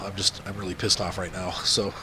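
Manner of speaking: ordinary talk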